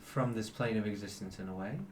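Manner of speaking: measured, grave and patient; celestial angel. Acted. GM voices the celestial.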